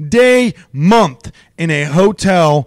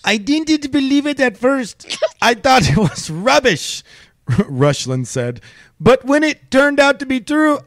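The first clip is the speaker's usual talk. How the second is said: lightly put-on Russian accent, higher; mock Russian accent; 8 semitones higher than usual. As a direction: read the quote in a hammed-up Russian accent, rolling R's. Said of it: "do you know how to do a Russian accent".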